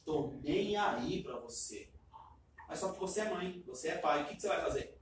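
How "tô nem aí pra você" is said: low, slow, dismissive mumble; a hint of a sullen teenager; sulky child. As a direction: shrug it off like a defiant kid. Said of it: "ele tá falando o quê pra você".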